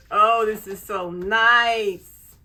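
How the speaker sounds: loud, slow gushing; real loud joy; loud delight. Real, not acted. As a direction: savour every word, overjoyed. Gushing over the planner.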